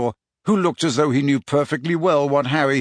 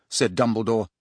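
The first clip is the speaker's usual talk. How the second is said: ordinary delivery, nothing marked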